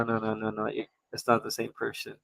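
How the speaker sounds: ordinary talk, nothing marked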